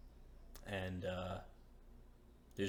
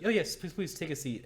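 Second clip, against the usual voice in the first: higher, louder eager invitation; eager host; 10 semitones higher, 9 dB louder than usual. Acted. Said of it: "she's like".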